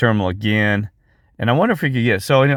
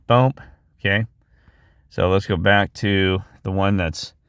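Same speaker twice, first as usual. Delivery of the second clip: normal talking voice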